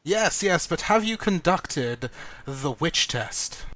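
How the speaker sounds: loud, slow heroic address; grand oration. Acted. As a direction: hold the crowd, slow and grand. Hero addressing the mob.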